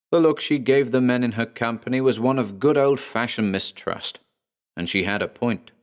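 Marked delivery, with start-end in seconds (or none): none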